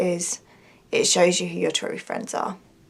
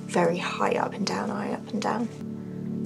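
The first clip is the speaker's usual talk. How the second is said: whispering; 8 dB quieter than usual. Real, not acted. Whispered aside.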